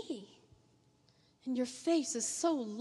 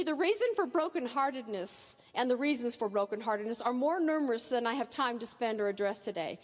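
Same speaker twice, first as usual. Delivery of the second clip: ordinary talk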